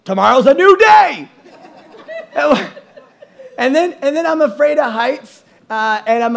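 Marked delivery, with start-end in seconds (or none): 0.1-1.3 mock chipper. Husband retold cheerful bedtime.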